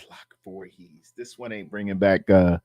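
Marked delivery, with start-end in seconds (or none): 0.1-1.1 soft unvoiced whisper of the name; whispered to himself. Real, not acted. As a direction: breathe the name out, barely audible.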